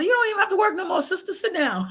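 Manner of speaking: high, teasing boss-of-the-house tone; playful teasing. Retold or imitated. Son teasing his mother about retiring, playful. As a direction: playfully order mother to sit down.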